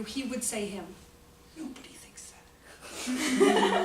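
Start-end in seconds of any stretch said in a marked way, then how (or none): 1.6-3.8 whispered laughing aside. Real, not acted. Laughing aside about husband.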